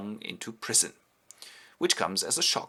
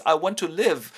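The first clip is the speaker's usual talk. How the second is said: louder, higher theatrical begging; desperate plea; 7 semitones higher, 6 dB louder than usual. Retold or imitated. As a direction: plead melodramatically for life. Homburg pleads for his life.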